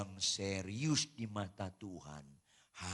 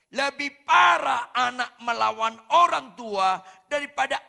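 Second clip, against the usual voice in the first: loud, much higher shouted preaching; preaching at full force; 11 semitones higher, 16 dB louder than usual. Real, not acted. Preacher shouting to the congregation that defying parents is worse, full-force preaching. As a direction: drive the point home with full force.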